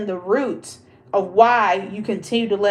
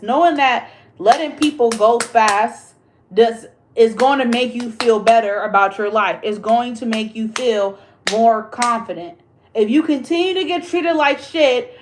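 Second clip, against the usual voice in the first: ordinary talk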